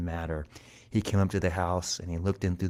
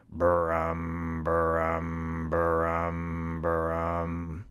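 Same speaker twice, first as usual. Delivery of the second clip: lower, rolled humming brums; purring drum; 3 semitones lower, much slower than usual. A narrator's reading. Cat's stomach drums after swallowing, steady humming purr. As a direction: imitate a steady rumbling purr. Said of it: "his stomach went".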